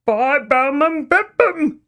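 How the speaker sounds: slow garbled "Buy bum and bed bum", no teeth; toothless shout. Acted. Grandad urging the girls to dive, toothless shout. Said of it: "Grandpa shouted".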